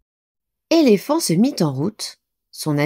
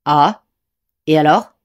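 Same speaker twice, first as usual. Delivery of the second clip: impatient; 5 dB louder than usual. A narrator's reading. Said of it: "s'impatienta Éléphant".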